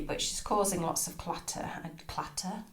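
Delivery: normal talking voice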